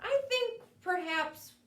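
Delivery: plain reading voice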